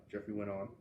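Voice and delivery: ordinary delivery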